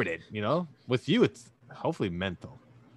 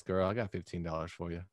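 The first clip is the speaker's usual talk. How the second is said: normal talking voice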